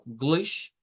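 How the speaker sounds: GLISH of English cut out on its own, slowly; second syllable alone. Shown as a demonstration. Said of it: "esto sería la segunda sílaba". ("glish", la segunda sílaba, aislada)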